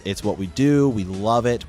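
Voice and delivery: ordinary delivery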